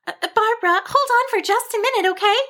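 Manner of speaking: high-pitched, quick little sheep voice; hurried sheep. A narrator's reading. Cassandra asks Barbara to wait, hurried. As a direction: blurt it out high and hasty.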